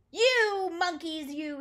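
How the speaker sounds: high, loud, drawn-out telling-off; scolding. A narrator's reading. Peddler shaking his finger at monkeys, loud storybook scolding. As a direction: stretch the first word into a scold. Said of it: "This made the peddler angry".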